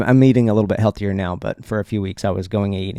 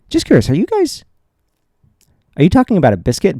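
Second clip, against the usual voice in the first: high, disbelieving squeak of a question; mock-innocent high-pitched disbelief; incredulous question; 8 semitones higher, 5 dB louder, faster than usual. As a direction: ask as if you can't believe it's about a biscuit. Asking in disbelief about biscuit.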